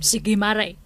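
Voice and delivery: usual speaking voice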